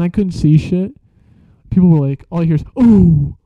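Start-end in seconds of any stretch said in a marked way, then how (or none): 2.8-3.4 loud alarmed oh; shout. Retold or imitated. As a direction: call out in shock. People reacting loudly.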